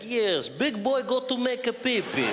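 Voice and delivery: slow, hammed-up tough-guy Russian accent. Man walking to the toilet at midnight, tough Russian accent. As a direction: talk yourself brave in a thick Russian accent. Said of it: "speak to myself in a Russian accent".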